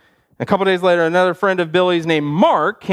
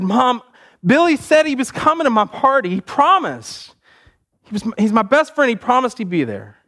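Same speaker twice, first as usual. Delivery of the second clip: put-on higher-pitched, hurt kid, no sobs; mock tearful plaint; 7 semitones higher than usual. Crushed boy telling his mom, lightly acted upset. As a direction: plead to Mom like a let-down boy. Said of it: "he was crying, and he cried".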